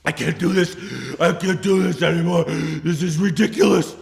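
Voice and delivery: high, loud mock-sobbing wail; sobbing breakdown. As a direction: cry it out hysterically, hammed-up. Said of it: "I was that bad".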